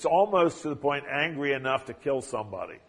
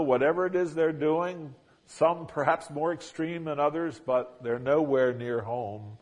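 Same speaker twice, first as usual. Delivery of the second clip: usual speaking voice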